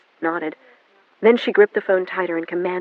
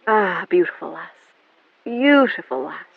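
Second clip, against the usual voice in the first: Irish lilt; slower than usual. A narrator's reading. Jamie O'Donnell voiced.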